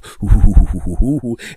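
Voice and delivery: loud, rapid run of hoo-hoo-hoo; mock lovemaking hoots. Comedian imitating being in the middle of lovemaking, loud hooting. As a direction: pant out comic hoots in a rapid burst.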